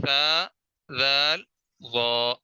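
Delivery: sa, zaal, waa with tongue tip between teeth; Arabic interdental letters. Shown as a demonstration. Tajweed teacher demonstrating "سا، ذال، وا" to students. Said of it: "زبان کی نوک پھیلا کر".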